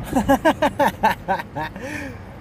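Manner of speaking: risa aguda que se apaga; ríete de tu propia frase; risa alegre. Real, not acted. Laughs after calling himself chingón. (risa aguda tras llamarse chingón)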